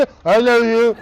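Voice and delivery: slow, mushy "I love you" with no teeth; toothless mock. Son mocks the toothless widow he is offered, slow mushy voice. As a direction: mimic a toothless old bride saying I love you. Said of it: "ਇੰਝ ਬੋਲੇਗੀ".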